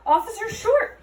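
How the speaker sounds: high, loud whining plea; whined. A narrator's reading. Mulch the dwarf whining at Holly when caught. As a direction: whine her name pitifully. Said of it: "he whined".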